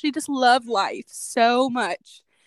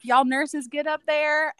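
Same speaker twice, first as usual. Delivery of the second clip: put-on Southern accent; 4 semitones higher, slower than usual. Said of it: "you just sounded so Southern".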